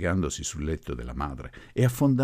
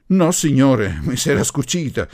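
loud, higher denial; emphatic retort; 8 semitones higher, 10 dB louder than usual. A narrator's reading. Professor answering his opponent about the sleeve, emphatic retort. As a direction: contradict forcefully.

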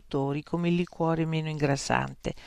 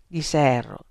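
ordinary delivery, nothing marked

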